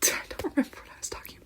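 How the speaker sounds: hushed whisper after losing the thread; lost-my-place whispered aside; whispering to oneself. Real, not acted. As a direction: whisper it quickly to yourself. Loses her thread, mutters to herself.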